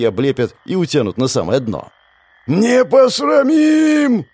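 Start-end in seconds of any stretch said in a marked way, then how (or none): 2.5-4.3 high, slow, loud battle shout; rallying war cry. A narrator's reading. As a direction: trumpet it to rally your courage. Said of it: "призывно протрубил совмак".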